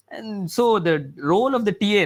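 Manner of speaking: normal talking voice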